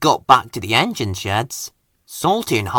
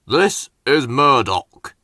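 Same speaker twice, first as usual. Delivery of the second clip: slow, lightly voiced Fat Controller introducing; slower than usual. Fat Controller introducing Murdoch to the engines, slow and proud. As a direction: announce the new engine with slow dignity. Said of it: "said the Fat Controller".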